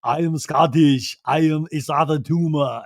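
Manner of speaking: Scottish attempt that comes out Austrian, like Schwarzenegger; imitating a bad Scottish accent gone Austrian; [name]'s failed Scottish accent. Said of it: "sounds exactly like Arnold Schwarzenegger".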